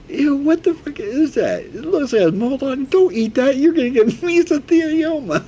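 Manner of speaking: squeaky grossed-out impression; imitating Chisel. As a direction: recoil in high-pitched disgust. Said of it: "Chisel goes".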